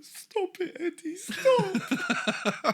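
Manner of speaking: very high, drawn-out, laughing plea; mock pleading old man. Co-host mimics the dad begging Eddie, high laughing plea. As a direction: wail it like a helpless father. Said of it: "Chavo's dad is just there like".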